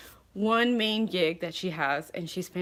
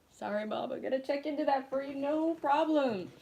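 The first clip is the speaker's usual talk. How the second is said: higher, slowing sing-song promise; mock sweet reply to mom; 8 semitones higher than usual. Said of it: "and I was like".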